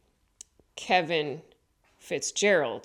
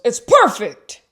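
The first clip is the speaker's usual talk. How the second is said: sudden praise; 6 semitones higher, 14 dB louder than usual. Retold or imitated. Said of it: "And then he goes".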